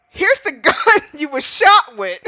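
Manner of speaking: giggling through a mock accusation; laughing mock line. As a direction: try to deliver it straight but crack up. Retells a courtroom line.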